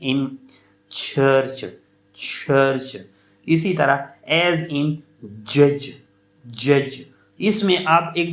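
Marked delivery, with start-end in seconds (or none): 1.0-3.0 church as an isolated model, each ch stopped then released; affricate demo. Shown as a demonstration. Teacher showing students affricates, church with each ch released. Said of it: "इस किस्म के sound में होते हैं". 5.6-6.9 judge as an isolated model, each j sound stopped then released; affricate demo. Shown as a demonstration. Teacher showing students affricates, judge with a released final sound. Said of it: "ये एफ्रिकेट sounds होते हैं".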